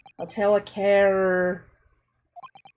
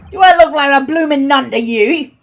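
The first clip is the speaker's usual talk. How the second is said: louder, indignant Cockney retort; 3 semitones higher, 9 dB louder than usual. Acted. Girl retorting to the nunnery jibe in Cockney. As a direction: snap back in a Cockney accent. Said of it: "I lost part of me accent".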